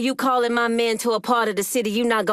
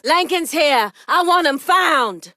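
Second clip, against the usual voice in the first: loud, higher shouted command, slower on the second line; shouted order; 7 semitones higher, 4 dB louder, slower than usual. Acted. Crime boss shouting to his men to hunt Lincoln down, loud commanding shout. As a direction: bark the order across the room.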